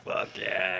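soft, breathless whispered cheer; whispered fuck yeah. Retold or imitated. Watching the sword scene, an awed whispered cheer. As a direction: hush it like an awed fan. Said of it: "I'm like".

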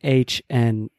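F A H N one letter at a time; spelling out. Shown as a demonstration. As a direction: spell it slowly, pausing between letters. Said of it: "is how you'd say it".

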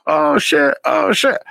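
high gasping fan reaction; fans gasping oh shit. Retold or imitated. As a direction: squeal it like a starstruck follower. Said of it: "And people are like".

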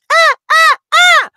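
put-on very high, loud baby wail; baby crying. Imitating a baby crying in the crib, high loud wails. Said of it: "they're going".

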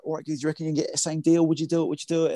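a light London geezer pitch; Mark Watts London geezer voice. Retold or imitated. Producer voicing Mark's London geezer accent asking for a favour. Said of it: "so Mark was like".

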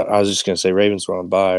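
ordinary delivery

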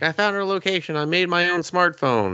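high, lightly voiced pouty boy. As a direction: whine it like a smug kid.

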